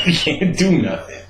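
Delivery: usual speaking voice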